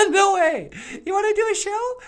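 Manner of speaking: very high, loud cartoon puppet greeting; high puppet bonjour. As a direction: squeak it out like a bubbly puppet. Puppet character voice.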